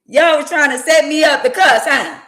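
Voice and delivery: high, loud accusation; busting the audience for trying to make her cuss; calling them out. Real, not acted.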